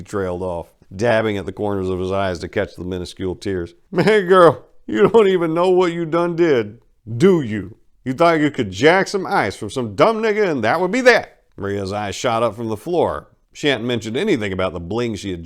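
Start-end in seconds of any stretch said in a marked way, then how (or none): 3.9-11.4 loud, high, taunting crime boss; Duke Willie's voice. Acted. Crime boss taunting Maria, high and loud. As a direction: lord it over the girl who crossed his boys.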